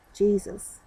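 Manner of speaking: ordinary delivery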